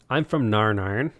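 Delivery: teasing Northern Irish impression; Northern Irish accent. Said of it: "The fun the way they talk".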